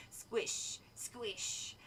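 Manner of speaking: whispered squish. A narrator's reading. Sound of the blob approaching.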